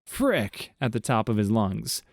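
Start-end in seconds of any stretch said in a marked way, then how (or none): 0.0-0.7 high-pitched mock scream of frustration. A narrator's reading. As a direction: yelp it out high, like he just lost a bet.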